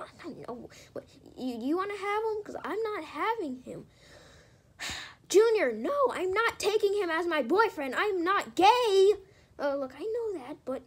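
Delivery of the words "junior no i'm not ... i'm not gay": loud, indignant refusal; loud, exasperated no; loud protest. Acted. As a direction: push back hard at Junior. Yoshi refuses boyfriend doll.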